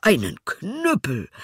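hohe, langsame ungläubige Frage; verächtlich staunen; ungläubig. Acted. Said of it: "rief der Vater". (ungläubiger Vater)

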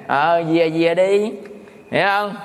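normal talking voice

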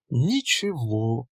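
slow, drawn-out languid sigh of a word; languid drawl. Acted. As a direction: stretch it out lazily and coyly. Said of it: "томно протянула Мурлыкина".

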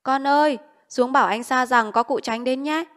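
calling out loudly. Acted. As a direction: call out loudly so the hidden man hears the warning. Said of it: "bà cụ nói to lên rằng".

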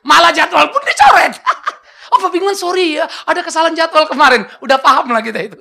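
laughing story. Real, not acted. Laughing about being uninvited.

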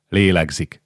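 ordinary delivery, nothing marked

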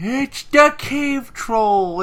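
high, loud, slow put-on voice; cave troll announcement. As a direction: herald the monster with hammed-up drama.

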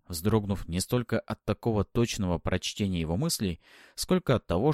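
plain reading voice

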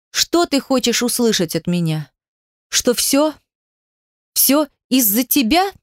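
mounting upset with halting questions; bitter hysteria. A narrator's reading. Heroine blames Demyan for everything, bitter hysteria. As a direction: throw the blame at him, close to hysterics. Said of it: "меня снова охватывает истерика".

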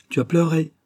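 high-pitched startled question; acted surprise, pitch jumping up; startled concern. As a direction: ask it high, taken aback. Cousin notices she has cried.

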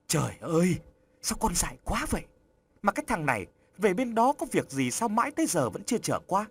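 a fretful mother's alarmed outcry; kêu lên lo lắng. A narrator's reading. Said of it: "Bà Hai kêu lên lo lắng". (bà mẹ hốt hoảng kêu lên, giọng cao và nhanh)

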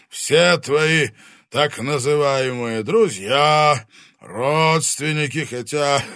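loud, slow, high booming dragon; menacing fire dragon voice; booming arrogant dragon. Acted. Dragon persuades son.

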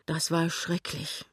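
slow, relieved exhale of words; drawing deep breath. A narrator's reading. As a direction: say it slowly, catching your breath. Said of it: "sagt Lämmchen tief atemholend". (langsam, tief atemholend)

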